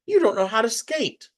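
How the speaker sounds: high, dismissive big-brother voice; scoffing brother. A narrator's reading. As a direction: brush her off like a know-it-all sibling. Brother scoffing at her dream.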